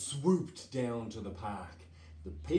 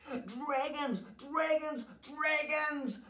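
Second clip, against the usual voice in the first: very high, panicked townsfolk cry; high-pitched acted alarm; storybook scream. A narrator's reading. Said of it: "they screamed".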